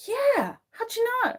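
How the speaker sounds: very high, put-on amazed voice; mock surprise. Streamer answering people who spot the accent, mock surprise. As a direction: squeal it in feigned astonishment.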